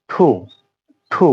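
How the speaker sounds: "ঠু" with a puffed th-like t; careful model "ঠু", heavily aspirated. Shown as a demonstration. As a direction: say "ঠু" with a burst of air after the t. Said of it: "এটা হবে".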